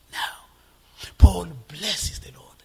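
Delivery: slow, unvoiced whisper. Real, not acted. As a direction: breathe it to them. Whispered aside about Paul.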